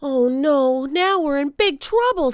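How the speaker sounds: high, loud worried cry; boy alarmed by the storm. A narrator's reading. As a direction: panic as the wind starts. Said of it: "said Jack".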